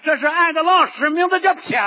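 hearty Shandong accent, calling out 皮特 loud. Shown as a demonstration. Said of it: "山东人叫出来特别亲切".

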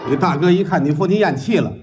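ordinary talk

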